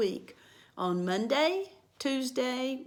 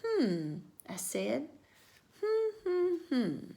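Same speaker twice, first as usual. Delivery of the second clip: thinking hmm; 5 semitones higher, 5 dB quieter, slower than usual. Acted. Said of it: "tapped on my chin".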